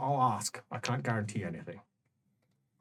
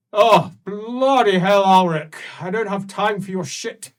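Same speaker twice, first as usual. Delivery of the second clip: irritated roar; 8 semitones higher, 12 dB louder than usual. Acted. Graf snapping at Alric from his chair, loud and furious. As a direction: blast him like a drunk, grieving ruler. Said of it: "he says".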